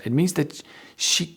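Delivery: ordinary talk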